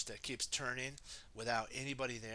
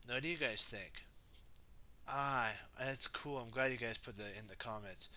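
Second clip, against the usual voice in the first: ordinary delivery, nothing marked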